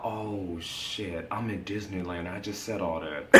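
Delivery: a hushed oh-no moment; hushed realization. Retold or imitated. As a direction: mutter it in dismay. Crystal realizing, retold.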